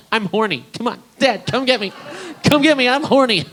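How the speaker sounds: put-on high child calling her dad; little girl voice. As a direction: play a kid shouting in the park. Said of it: "she's like".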